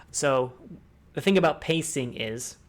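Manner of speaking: ordinary talk, nothing marked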